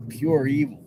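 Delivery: normal talking voice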